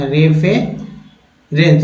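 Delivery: रेफरेंस drawn out slowly as re-fuh-rens; very slow model of रेफरेंस; slow careful word. Shown as a demonstration. Teacher to class, corrected slow रेफरेंस. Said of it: "यहाँ भी ए हो जाएगा".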